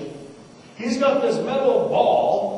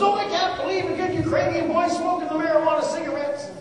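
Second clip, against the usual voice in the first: Ukrainian grandma; 8 semitones higher, 5 dB quieter than usual. Retold or imitated. Grandma scolding her grandson over weed, high and scandalised. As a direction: pitch it up like a shocked babushka.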